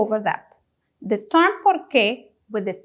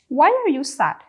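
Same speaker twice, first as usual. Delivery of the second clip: ordinary talk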